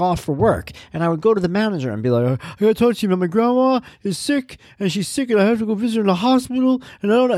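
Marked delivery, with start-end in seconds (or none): 2.4-7.4 higher, anxious pleading voice; young employee begging his boss about a sick grandma; pleading young worker. Retold or imitated. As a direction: beg the manager for time off. Young him pleading to manager.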